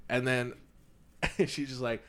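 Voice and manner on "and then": ordinary talk, nothing marked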